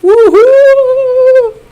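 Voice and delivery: high, loud whoop of joy; elated whoop. Real, not acted. Fan celebrating Brazil's Oscar, whooping. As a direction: let out a real victory whoop. Cheering a live Oscar win.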